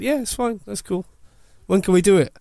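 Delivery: mock-chirpy, happy to go; eager girl. Girl's eager reply voiced.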